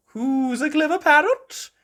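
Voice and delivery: high, loud doting granny; Granny Pig beaming. A narrator's reading. Granny Pig praising Polly, high doting voice. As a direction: coo it at the pet. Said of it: "beaming at Polly".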